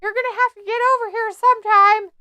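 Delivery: high, pleading voice for a stuck tag partner; high helpless partner voice. Retold or imitated. Dax calling to his partner from the corner, high and pleading. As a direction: squeak it out like a helpless buddy. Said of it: "Dax is over in the corner going".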